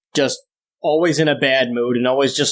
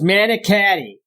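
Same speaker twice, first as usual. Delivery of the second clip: manicotti with flat nasal Buffalo a's; mock the Buffalo vowels in manicotti; imitating a Buffalo accent on manicotti; 5 semitones higher than usual. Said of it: "It was worse than a Boston person speaking".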